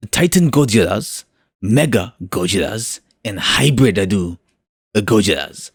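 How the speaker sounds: put-on Japanese accent, phrase by phrase; mock Japanese accent. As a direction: try a hammed-up Japanese accent, pausing between phrases. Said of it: "say this in a Japanese accent".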